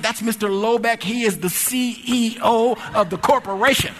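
high-pitched, emphatic revelation; aghast. Retold or imitated. Supervisor telling clerk he challenged the CEO, aghast. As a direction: spell out the title in alarm.

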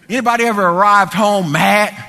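calling out. Real, not acted. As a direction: throw the question out at full preaching volume. Preacher calls out to congregation.

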